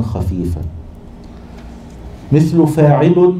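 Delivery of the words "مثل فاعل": "فاعل" said slowly and higher, each syllable marked; metre demonstration. Shown as a demonstration. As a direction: sound out "فاعل" clearly for the class. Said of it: "يزاد عليها تن". (نطق "فاعل" ببطء وبصوت أعلى لتوضيح الوزن)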